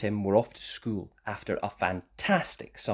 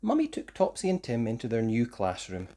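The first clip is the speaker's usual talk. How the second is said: usual speaking voice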